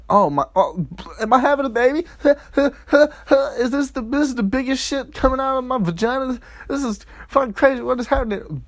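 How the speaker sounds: high, panicky woman in labor; mock panic. Acting out a woman shocked by giving birth, mock panic. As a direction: babble in high-pitched comic alarm.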